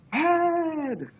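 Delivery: high, drawn-out mock crowd shout. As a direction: shout it back like an arena crowd. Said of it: "the crowd would shout".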